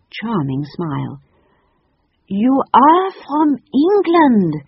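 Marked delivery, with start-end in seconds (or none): 2.3-4.5 high, loud, slow cry of joy; delighted cry. A narrator's reading. The French lady greets the children, high loud delighted cry. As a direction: gush with delight at the English children. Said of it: "she cried".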